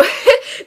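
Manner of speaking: airless high squeak of a laugh; breathy high laugh. Real, not acted. Player cracking up at the game, breathy squeak. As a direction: let a voiceless laugh escape.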